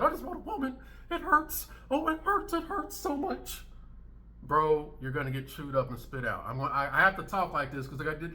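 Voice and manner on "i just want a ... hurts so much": high-pitched, hammed-up hurt; mock upset whining. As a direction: mimic a wounded complainer in a squeaky high voice. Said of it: "dudes out here upset".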